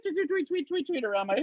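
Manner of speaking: high, soft cartoon tweeting; imitated bird tweets. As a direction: chirp like dazed cartoon birds. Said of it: "with birds tweet".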